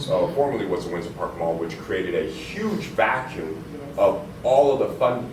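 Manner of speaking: usual speaking voice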